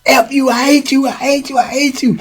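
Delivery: high, raging repetition; furious. Retold or imitated. Boo Boo swinging at Charles, high furious rant. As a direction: spit it out in a high fury. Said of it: "he was just going off".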